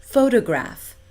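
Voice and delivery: photograph stressed on the first beat, PHO-to-graph; correct stress. Shown as a demonstration. Teacher modelling the standard stress. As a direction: open strongly on pho in photograph. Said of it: "instead of".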